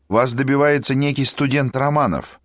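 high, prim operator voice; telephone operator. Acted. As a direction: announce the caller crisply. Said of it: "сказал оператор".

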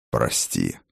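low, hushed apology; soft apology. A narrator's reading. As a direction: apologise quietly to your wife. Муж извиняется перед женой. (тихое, низкое извинение)

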